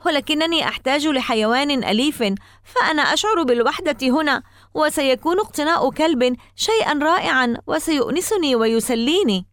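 high-pitched, pleading insistence; insistent. A narrator's reading. Boy begging parents to keep the dog, insistent. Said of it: "وقلت بإصرار".

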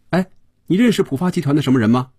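quick, high-pitched call to the driver; abrupt questioning. A narrator's reading. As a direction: fire the question at a stranger, fast and high. 菲比突然冲司机发问. (冲着司机急促发问，声调高)

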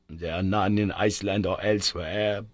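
plain reading voice

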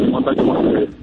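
words drowned by wind; cutting out in the wind; windy phone line. Real, not acted. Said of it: "se te escucha mal ahora por el viento".

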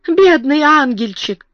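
slow, high cooing; drawn-out pitying endearment to a crying child; agitated tenderness. A narrator's reading. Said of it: "то грозно, то нежно".

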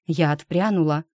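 plain reading voice

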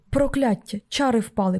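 plain reading voice

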